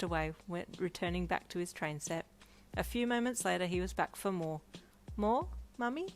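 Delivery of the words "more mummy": put-on high cute toddler ask. A narrator's reading. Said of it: "he asked with a cute little head tilt".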